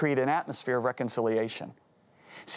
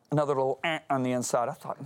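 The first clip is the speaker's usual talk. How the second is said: normal talking voice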